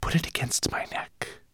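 slow whispered serpent hiss. A narrator's reading. The serpent examines Ben's scale, whispered hiss. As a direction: hiss it softly, drawing out the sibilants. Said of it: "she hissed".

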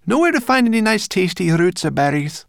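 very high, loud character voice; coaxing brownie. A narrator's reading. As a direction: wheedle for food as a little brownie. Sorrel coaxes the dwarfs.

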